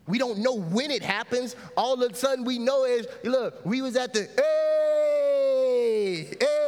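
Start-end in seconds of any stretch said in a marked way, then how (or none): 4.4-6.7 long, high, drawn-out "hey"; whooping it up on the dance floor; club party holler. Acted.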